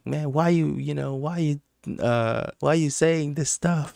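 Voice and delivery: high, soft mocking whine; mock complaining. Imitating angry group members.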